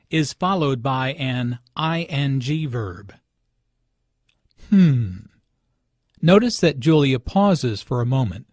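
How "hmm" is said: demonstrated pondering hum; short thoughtful "hmm"; thinking hmm. Shown as a demonstration. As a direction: hum as if considering first. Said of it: "used to think about something first".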